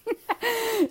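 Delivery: high-pitched laugh; amused laugh. Real, not acted. Laughs at comment on fast talking.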